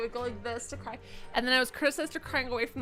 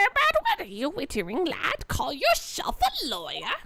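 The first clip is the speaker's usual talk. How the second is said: higher, hammed-up berating voice; scolding game character; 5 semitones higher, 4 dB louder than usual. Game character berating a lad as a lawyer, mock indignation. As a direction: put on an indignant, raised character voice.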